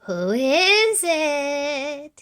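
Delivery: put-on high, slow sugary old-lady call; wolf fakes Granny, sweet and high; sweetest Granny voice. A narrator's reading. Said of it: "in his sweetest Granny voice".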